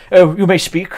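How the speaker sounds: pompous high imperial drawl; hammed-up bored emperor; mock Napoleon. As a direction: lord it over the messenger, mouth half full. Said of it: "just going".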